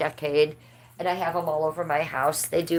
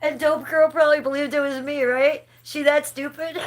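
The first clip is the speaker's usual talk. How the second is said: amused mocking; 8 semitones higher than usual. Real, not acted. Laughing while mocking troll.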